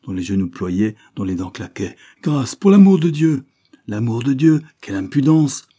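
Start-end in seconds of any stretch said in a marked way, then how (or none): 2.2-3.4 much higher, louder cry for mercy; terrified gentleman begging the mob; desperate plea. A narrator's reading. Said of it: "criait la victime". 3.9-5.7 mocking jeer. A narrator's reading. Mob mocks the victim's plea.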